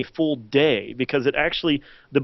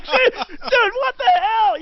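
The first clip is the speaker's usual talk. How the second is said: much higher, laughing cry of outrage; exasperated outrage; much slower than usual. Retold or imitated. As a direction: squeal at the betrayal, laughing. Absent network bosses outrage.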